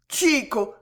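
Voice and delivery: high, distraught acted sob of a name; Juana sobbing. A narrator's reading. Juana calls to her dying beloved, acted sobbing. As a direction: call out to him as if in tears. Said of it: "sanglota la petite Juana éperdue".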